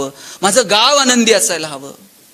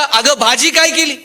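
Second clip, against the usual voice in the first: raised, higher calling voice; आवाज देणे; 5 semitones higher, 4 dB louder than usual. Retold or imitated. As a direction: call to the wife from the kitchen door. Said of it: "यानं दारातूनच आवाज दिला". (जरा मोठ्याने हाक मारत)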